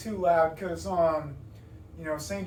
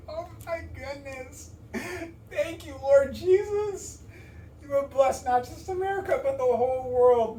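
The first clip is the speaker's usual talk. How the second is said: overjoyed prayer; 11 semitones higher than usual. Retold or imitated. Overjoyed prayer at election news.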